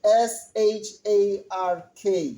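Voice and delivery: slow letter-by-letter spelling; careful spelling drill. Shown as a demonstration. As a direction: spell each letter slowly again for the class. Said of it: "the shark is spelled".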